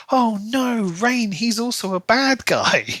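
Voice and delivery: high, hammed-up gasp of dismay; mock shock. Imitating readers reacting to Rain's twist, mock shocked and high. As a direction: play the big twist reveal with fake horror. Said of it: "It's supposed to be like".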